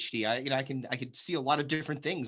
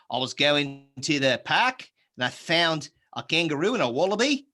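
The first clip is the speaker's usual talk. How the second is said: Australian accent; 3 dB louder than usual. Shown as a demonstration. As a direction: exaggerate the Australian rise at line ends. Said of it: "the thing with the Australian accent".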